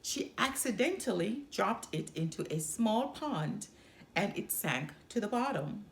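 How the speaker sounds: ordinary delivery, nothing marked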